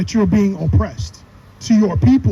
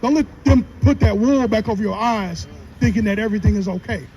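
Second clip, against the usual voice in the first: ordinary talk, nothing marked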